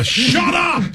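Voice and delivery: shouting. Retold or imitated. Joining the mock shouting match.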